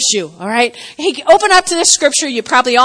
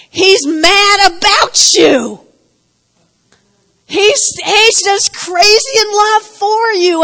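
fervent; 7 semitones higher, 5 dB louder, slower than usual. Acted. Preaching God's love with force.

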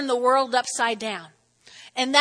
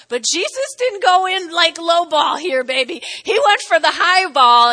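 preaching loud; 3 semitones higher, 8 dB louder than usual. Real, not acted. Preacher building to climax.

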